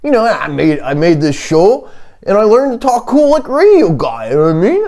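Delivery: high put-on comic voice; supposed real voice. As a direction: keep chatting in the high mock voice. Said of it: "I'm a guy who sounds like this".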